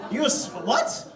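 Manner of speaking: normal talking voice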